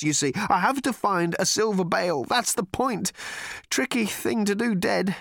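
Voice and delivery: high, hurried excuses; nervous Arthur voice. Acted. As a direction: gabble it out anxiously.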